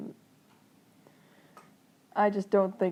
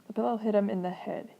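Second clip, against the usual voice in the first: ordinary delivery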